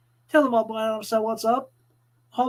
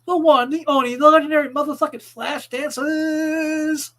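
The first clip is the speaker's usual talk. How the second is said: announcer hype; 5 semitones higher, 6 dB louder than usual. Acted. Hype introduction.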